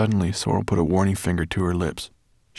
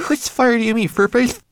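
very high, louder, tiny manikin voice; Twigleg voice; 8 dB louder than usual. Acted. Tiny Twigleg asks Sorrel about the fire, high piping voice.

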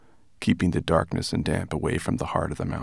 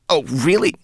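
high, loud offended rat; indignant gasp; 9 semitones higher, 6 dB louder than usual. A narrator's reading. Rat insulted by brownie's remark, indignant. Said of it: "Rat gasped indignantly".

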